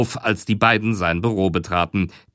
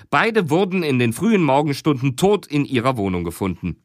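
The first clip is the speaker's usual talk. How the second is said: ordinary delivery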